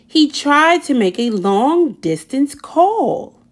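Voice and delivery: plain reading voice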